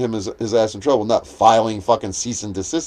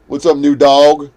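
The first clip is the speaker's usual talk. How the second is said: slow, louder mock of his greeting; 3 semitones higher, 8 dB louder, slower than usual. Vlogger mimics a man greeting him on video, slow and louder. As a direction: drag it out mockingly. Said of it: "He says".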